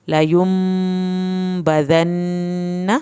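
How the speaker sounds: very slow, stretching the meem sound for a full beat; held nasal meem. Shown as a demonstration. Teacher showing students the held meem, very slow drawn reading. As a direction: hold the meem one alif long before the ba. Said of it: "ایک الف کے برابر دیر لگانی ہے".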